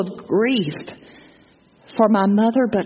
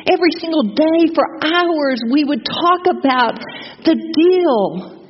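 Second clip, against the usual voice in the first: retold angry outburst; 7 semitones higher than usual. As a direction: rail at God as if betrayed. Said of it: "I was so angry. I said".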